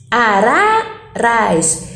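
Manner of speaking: "araras" very slow, the middle "ra" stretched and split from the last; stress on middle syllable. Shown as a demonstration. Teacher showing the stressed syllable, "araras" drawn out on the middle "ra". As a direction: drag out the second syllable of "araras", then drop on the last. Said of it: "O primeiro rá, né, de araras".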